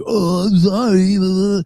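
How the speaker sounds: slow, slurred meth head apology. Retold or imitated. Pulled-over meth head apologizing to the cop, mock slurred voice. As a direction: imitate a strung-out driver mumbling sorry. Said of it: "some meth head to be like".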